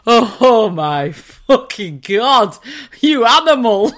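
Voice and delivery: slow, loud, horrified exclamation; mock appalled. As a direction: draw it out in loud disgust, imitating a husband. Imitates husband's appalled reaction.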